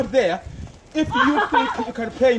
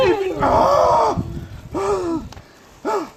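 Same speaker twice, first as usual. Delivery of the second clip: slow high yelp at the icy cold; real yelp of cold; 5 semitones higher, much slower than usual. Real, not acted. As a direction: cry out as the snow bites. Yelps in freezing snow.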